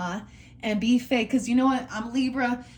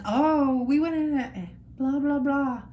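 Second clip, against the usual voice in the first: mocking gush; 5 semitones higher than usual. Mocking worshippers' gushing talk.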